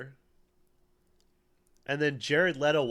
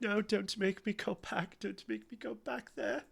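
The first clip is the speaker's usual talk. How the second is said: high, soft mock pleading; 8 semitones higher, 7 dB quieter than usual. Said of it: "He went".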